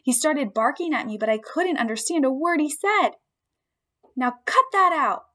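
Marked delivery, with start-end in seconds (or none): 4.1-5.2 small hamster squeaking a mild scolding; squeaky hamster scold. Acted. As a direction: squeak it at the dog to stop. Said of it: "I squeaked at him".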